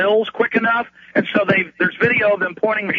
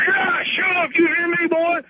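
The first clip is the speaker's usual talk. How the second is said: much higher, strained caricature of a cop; mock bullying cop; 10 semitones higher than usual. Cop silencing the EMTs, mock bullying. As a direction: imitate a cop ordering someone around.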